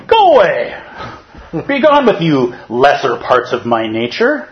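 high, loud mock scolding; self-righteous meditator. Meditator ordering his greedy thought away, high and loud. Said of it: "what I'm fond of doing is going".

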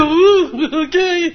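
put-on a naive girl caught off guard, half laughing; startled reply. Farm girl reacting to order.

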